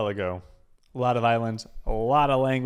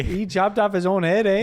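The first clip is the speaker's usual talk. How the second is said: hammed-up Canadian accent, louder, ending on a bouncy eh; mock Canadian; 8 semitones higher, 6 dB louder than usual. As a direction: play a jokey Canadian with a big eh. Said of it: "speak Canadian".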